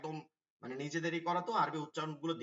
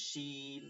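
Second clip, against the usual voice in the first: "শিন" with a long ee, softer and held; stretched vowel; 2 semitones lower, 8 dB quieter, much slower than usual. Shown as a demonstration. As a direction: draw out the middle of "শিন". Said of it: "একটু টান দিয়ে হবে". (নিচু স্বরে টান দিয়ে "শিন")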